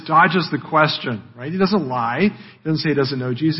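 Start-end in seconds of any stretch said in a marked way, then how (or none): none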